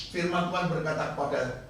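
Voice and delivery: ordinary talk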